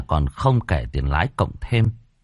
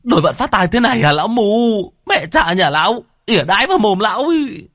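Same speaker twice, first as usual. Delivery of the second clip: higher, louder, fast furious cursing; cursing furiously; 12 semitones higher, 4 dB louder than usual. Acted. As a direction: scream abuse at the blind old man. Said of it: "Anh chửi um lên". (chửi um lên giận dữ)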